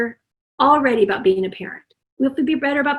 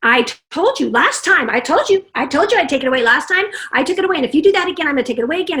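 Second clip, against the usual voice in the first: loud, fast exasperated scolding; nagging lecture; 3 semitones higher, 4 dB louder, much faster than usual. Acted. Angry mom lecturing the child about the truck, loud scolding. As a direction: scold like a fed-up parent. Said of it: "we go into the lecture".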